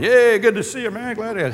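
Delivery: imitating casual party small talk; breezy party greeting. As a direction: greet like an old pal at a cocktail party. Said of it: "like at a cocktail party".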